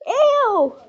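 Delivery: a high, loud disgusted "ew"; disgusted shriek. Real, not acted. Kid shrieks at her sister shoving a lollipop, disgusted. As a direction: recoil from the sticky lollipop with a shriek.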